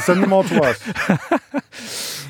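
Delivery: drawn out in a mocking put-on voice; sarcastic mock voice. Sarcastic mock northern welcome.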